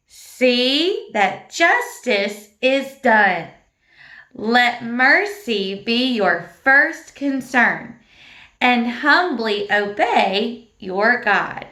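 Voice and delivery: slow, drawn-out verse chant; slow recitation. Shown as a demonstration. Teacher leading children through the whole verse slowly. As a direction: recite it slowly together with the class. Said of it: "Okay, here we go".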